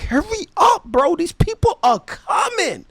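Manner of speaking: very high, strained imitation of pleading; mock panic. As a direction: whine it frantically, as if being chased. Retold panicked plea to hurry.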